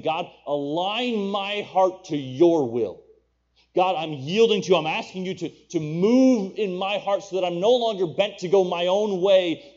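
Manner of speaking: high, loud pleading at full preaching force; fervent prayer. Acted. Preacher voicing Evan Roberts yielding his heart to God, fervent prayer.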